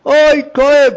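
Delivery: high, loud yelp of pain; shouting in pain. Acted. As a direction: yell out from a bellyache. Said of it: "צועק".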